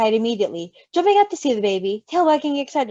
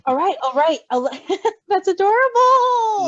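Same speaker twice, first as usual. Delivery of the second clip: higher, slow, giggly gush; laughing in character; 7 semitones higher, 6 dB louder, slower than usual. Real, not acted. Reader breaks while voicing Virgil, laughing and gushing.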